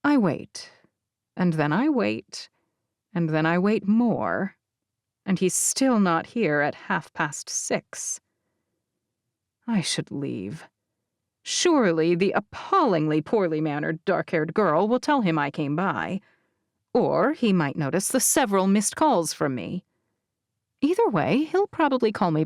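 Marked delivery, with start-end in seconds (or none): none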